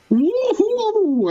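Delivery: very high whooping cheer; squealing woohoo. Acted. Player voicing the witness over her lunchbox, squealing cheer. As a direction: cheer like a kid at a treat.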